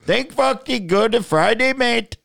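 hammed-up Aussie that drifts off target; bad Aussie accent. Shown as a demonstration. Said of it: "Let me try again".

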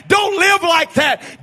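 shouted warning. Acted. The warning man shouting at the people, loud slow warning. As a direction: bellow the warning with weight on live. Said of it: "the voice of a man that's saying".

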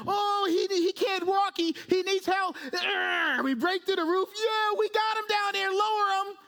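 high-pitched, strained urgency, hammed up; comical frantic friends. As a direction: play the friends heaving him through the roof, comically. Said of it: "almost comical here".

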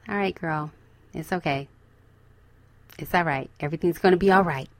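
calm yourself gently, almost under your breath; soothing self-talk. Acted. Soothing oneself after a bad thought.

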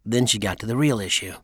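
plain reading voice

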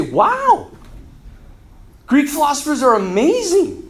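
high-pitched, wowed reaction; acted amazement. Conquered people finish reading Greek books, newly amazed. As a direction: marvel at it, pitch lifted.

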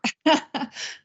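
brief high amused laugh; light laugh. Real, not acted. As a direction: laugh softly at the joke. Co-host laughs at the breath joke.

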